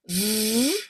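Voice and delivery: "V" held as a long voiced buzz; voiced V sound. Shown as a demonstration. Coach repeats the V sound for learners, long and voiced. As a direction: sustain "V" so the throat vibrates. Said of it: "We want a voiced vibration here".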